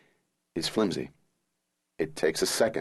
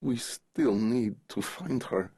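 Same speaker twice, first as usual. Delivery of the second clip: put-on slow, higher voice thick with a Russian accent; 4 semitones higher, 4 dB quieter, slower than usual. A narrator's reading. Dying father to his child, slow Russian accent. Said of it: "his voice still thick with a Russian accent".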